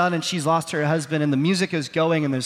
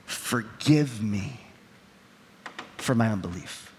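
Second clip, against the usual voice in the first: much lower and softer plea; pleading softly; 8 semitones lower, 6 dB quieter than usual. Retold or imitated. Husband begging his wife's forgiveness, soft low plea. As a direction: drop almost to a hush as you beg.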